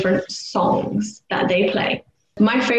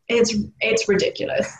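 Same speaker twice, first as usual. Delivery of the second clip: ordinary delivery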